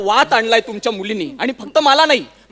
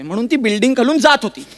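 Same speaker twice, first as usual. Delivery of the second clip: ordinary delivery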